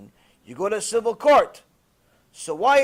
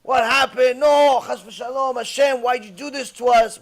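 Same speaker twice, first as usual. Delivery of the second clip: higher and louder mock wailing; panicked wailing; 5 semitones higher, 7 dB louder than usual. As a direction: cry out to God in panic. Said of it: "She starts hitting ourselves".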